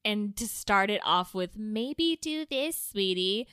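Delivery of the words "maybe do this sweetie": high, slow, sugary suggestion; condescending parent. Retold or imitated. Mimicking a critical parent advising their child, condescending. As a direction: imitate a patronizing parent, pitched up. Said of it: "It's so condescending".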